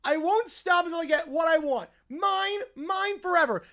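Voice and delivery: high, loud tantrum shriek; screaming bratty kid. Acted. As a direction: scream it like a spoiled child who won't share. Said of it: "screaming like crazy".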